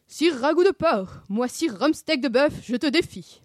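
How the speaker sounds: loud, drawn-out chivalric challenge; mock-heroic knight. Sammy playing knight challenges Scooby, mock-heroic. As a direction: proclaim it grandly like a knight.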